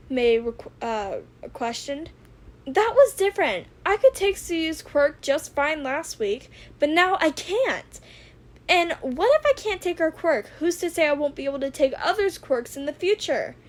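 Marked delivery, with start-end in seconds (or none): 2.7-13.6 raised, higher, louder agitated protest; a teen hero insisting he's fine, voice climbing; overreacting rant. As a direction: get worked up and defensive. Izuku overreacting, May says so.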